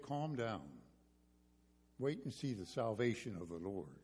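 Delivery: low, soft reassurance; calming. Shown as a demonstration. Moses reassuring the frightened Israelites, calming voice. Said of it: "how calming Moses' voice would have been".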